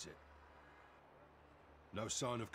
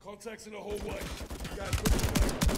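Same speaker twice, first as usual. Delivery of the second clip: shouting; 13 semitones higher, 14 dB louder than usual. Acted. Firefight, calling out to squad.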